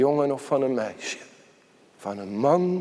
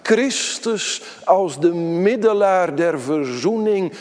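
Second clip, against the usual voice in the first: loud, high, driving pulpit delivery; preaching at full force; 6 semitones higher, 5 dB louder than usual. Acted. Preacher proclaiming the gospel to the congregation, loud and high. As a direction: preach it out, building with each clause.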